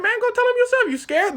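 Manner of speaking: goading, squeaky-high comeback; high-pitched taunt. Retold or imitated. Emperor daring the British, high and taunting.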